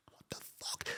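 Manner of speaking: whispered disbelief; barely voiced, incredulous curse; stunned whisper. Coach reacting to linesman's missed flag, stunned whisper.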